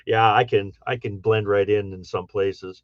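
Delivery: ordinary talk, nothing marked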